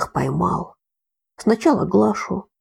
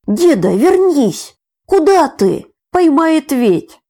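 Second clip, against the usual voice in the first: desperate shout; 9 semitones higher, 7 dB louder, slower than usual. A narrator's reading. Boy calling grandfather back.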